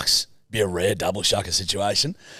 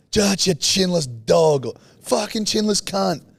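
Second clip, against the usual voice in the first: higher-pitched mock taunting; lightly voiced street heckler; imitated heckler; 7 semitones higher than usual. Strangers calling the host names in the street, heckling.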